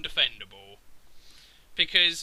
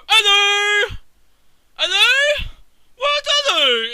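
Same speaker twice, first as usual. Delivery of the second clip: much higher and louder, a hammed-up call into the dark; mock Isaac calling out; 14 semitones higher, 10 dB louder, slower than usual. Mocking the game's hero, a loud high hammed-up hello. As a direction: shout hello like a clueless hero. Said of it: "Isaac will randomly pop up and go".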